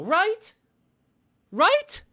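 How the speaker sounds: squeaky high right; incredulous high question. Real, not acted. Streamer begging for reassurance, squeaky high right. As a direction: pitch right way up in disbelief.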